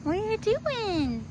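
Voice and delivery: high slow cooing question. Real, not acted. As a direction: coo it slowly to a pet. Cooing at pet.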